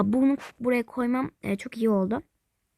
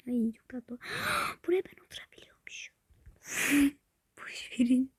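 soft unvoiced whisper; 5 dB quieter than usual. Real, not acted. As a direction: keep it hushed near the dog. Whispering so the dog calms.

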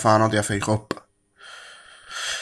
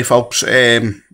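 ordinary talk

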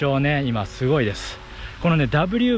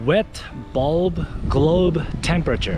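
English pronunciation; 2 semitones higher, much slower than usual. Shown as a demonstration. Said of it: "っていうんですね".

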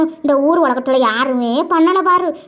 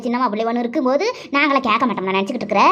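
normal talking voice

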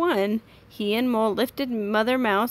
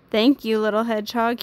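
ordinary delivery

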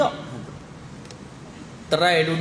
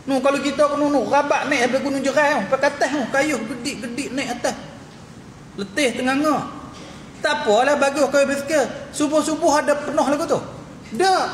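high, worked-up ridicule; mocking exaggeration; 7 semitones higher than usual. Real, not acted. Preacher ridiculing dawn cyclists to his congregation, mocking. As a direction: pitch it high, poking fun at their keenness.

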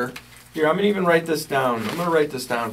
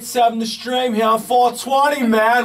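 put-on British accent; 7 semitones higher, 5 dB louder than usual. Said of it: "the British accent segment today".

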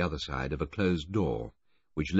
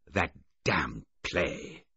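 furious; much slower than usual. A narrator's reading. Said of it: "Filmer said furiously".

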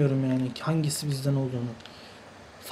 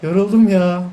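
tired; 4 dB louder than usual. Real, not acted. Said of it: "Vallahi yoruluyorum".

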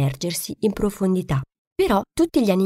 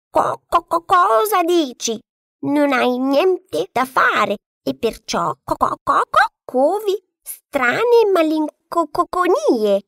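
very high, louder hen, slow, stuck on co-; stuttering clucking hen; 9 semitones higher, 4 dB louder, slower than usual. A narrator's reading. Hen scoffs at the duckling, clucking high. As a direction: cluck every word start like a flustered hen. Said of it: "questa gli rispose".

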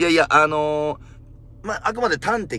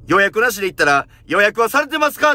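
much higher, loud demanding clerk; loud intimidating question; 8 semitones higher, 9 dB louder than usual. Retold or imitated. Receptionist intimidating a walk-in patient, loud and demanding. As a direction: bark it to cow the patient. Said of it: "大声で威圧してくる店員".

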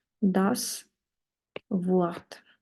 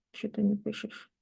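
ordinary delivery

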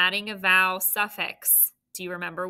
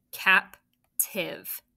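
"Captive" in two separated beats, cap, then tiv; split into syllables; 3 dB louder than usual. Shown as a demonstration. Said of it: "it's two syllables".

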